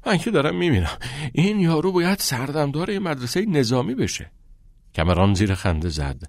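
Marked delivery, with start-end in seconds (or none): 0.1-4.3 high-pitched, winded complaint; acted panting, words broken up by breath. A narrator's reading. Exhausted boy grumbles after gym class, gasping. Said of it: "نفس نفس زنان و بریده بریده گفت".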